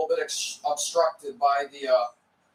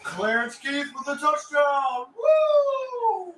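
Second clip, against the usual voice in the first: high excited cheer with a whoop; touchdown call; 9 semitones higher, slower than usual. Real, not acted. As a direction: cheer the scorer's name and whoop. Celebrating the touchdown.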